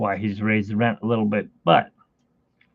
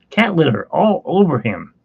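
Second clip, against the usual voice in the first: usual speaking voice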